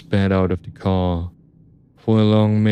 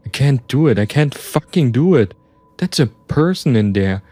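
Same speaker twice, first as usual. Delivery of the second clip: higher-pitched rising panic; freaking out; 6 semitones higher, 3 dB louder than usual. A narrator's reading. Narrator alone with gun and crate, freaking out. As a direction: protest in mounting desperation. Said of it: "I was starting to freak out".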